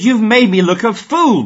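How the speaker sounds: loud, high furious cry at the donkey; angry shout. A narrator's reading. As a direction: yell it in outrage. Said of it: "Balaam shouted".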